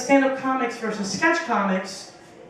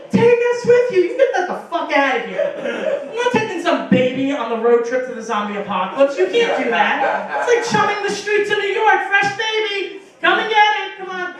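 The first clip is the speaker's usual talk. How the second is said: mock yelling; 7 semitones higher than usual. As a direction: shout her off like bait for zombies. Mom begging, then yelling back.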